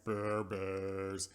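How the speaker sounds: drawn-out droning groan; mock dazed drone. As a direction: drone it like a dumbstruck man. Imitating Malcolm's dazed reaction.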